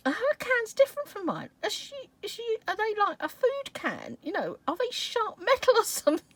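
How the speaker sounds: high-pitched, baffled thinking aloud; puzzled questioning. Retold or imitated. Puzzling over how Susie cut herself on cans, high questioning. Acting out her puzzled thoughts.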